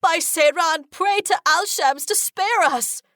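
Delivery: high, slow desperate plea; terrified plea. A narrator's reading. Sailor begging the others to pray, terrified. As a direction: beg the gods in panic. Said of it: "a sailor cries out in terror".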